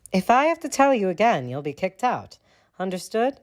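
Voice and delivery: high, clipped warning; stern librarian. A narrator's reading. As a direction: warn like a strict librarian.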